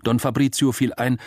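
plain reading voice

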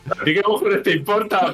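mock rude retort. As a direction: snap back rudely for the girls. Responde por ellas con burla. (réplica burlona y borde, más aguda)

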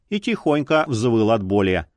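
ordinary delivery

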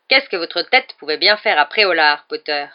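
a sneering teacher cornering a pupil; Snape. A narrator's reading. Snape interrogates Harry in a cold, menacing voice.

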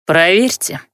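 said with an ominous smile; menacing smile. A narrator's reading. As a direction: smile it as a threat. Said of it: "повторил Дейморец и улыбнулся".

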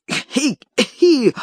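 put-on high, spiteful old man's titter; mocking high-pitched snicker; high spiteful giggle. A narrator's reading. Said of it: "закричал на Никитку".

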